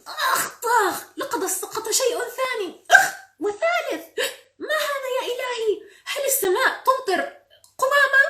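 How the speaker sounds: very high, loud storybook panic; shrieked, exaggerated disbelief; alarmed outcry. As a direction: exclaim in shock as things rain down. Character hit by falling garbage.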